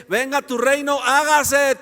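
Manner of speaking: loud, high, emphatic recitation; forceful teaching prompt; corrective recitation. Shown as a demonstration. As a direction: punch out the prayer line as a correction. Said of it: "lean bien, lean bien, dice".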